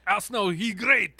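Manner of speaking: slow mock French accent; lightly mocking French accent. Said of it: "said it with a French accent".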